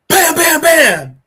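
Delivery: loud, high, rapid bams; imitated gunshots. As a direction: fire off the shots with your voice.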